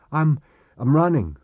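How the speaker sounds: harried. Acted. Fleeing Chris replying to Marek by earpiece, harried. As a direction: answer in a rush while fleeing.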